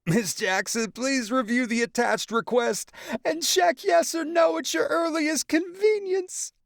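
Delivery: very high, prissy formal mock voice; mocking Lucky. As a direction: mimic the nerdy note. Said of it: "Paul Junior mocking Lucky".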